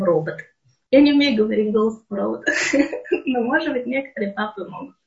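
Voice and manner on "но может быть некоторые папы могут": normal talking voice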